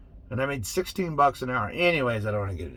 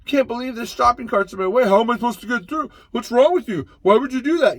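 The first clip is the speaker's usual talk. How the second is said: higher, louder ranting complaint; hammed-up grumbling, pitch and volume rising; mock angry shopper; 6 semitones higher, 5 dB louder than usual. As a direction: ham up an impatient shopper griping at everything. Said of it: "everyone's going around".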